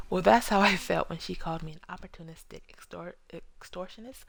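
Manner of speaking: ordinary talk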